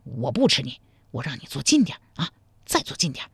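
lightly voiced man, low; low, mildly seductive coaxing. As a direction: coax her closer, softly in character. Man coaxes her closer.

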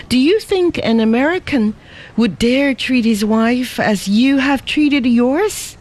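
high, heated reproach; angry scolding. Acted. Ada reproaches Lin Fo, high and scornful. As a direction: shame him with rising outrage.

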